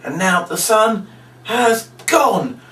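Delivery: high, hammed-up victory squeal; squeaky mock triumph, drawn out; gloating triumph. Shown as a demonstration. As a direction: crow over the kids like a fake winner. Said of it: "So you go".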